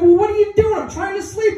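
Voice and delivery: high-pitched indignant complaint; mock whine at being kept awake; exasperated protest. Son to dad who woke him, exasperated.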